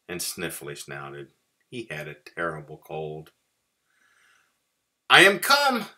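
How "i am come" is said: high, loud cheerful mouse; grand declaration. A narrator's reading. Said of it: "declared Mouse".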